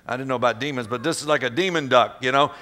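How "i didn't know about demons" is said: ordinary delivery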